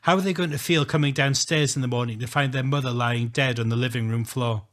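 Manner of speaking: ordinary delivery, nothing marked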